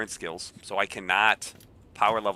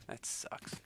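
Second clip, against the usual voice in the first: whispered; 17 dB quieter than usual. Real, not acted. Repeats it, first under breath.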